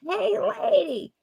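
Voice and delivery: high screechy whiny stooge voice; hammed-up squeal of a hurt stooge; Curly from the Three Stooges. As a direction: yelp like Curly getting punched. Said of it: "it sounds like Curly from the Three Stooges".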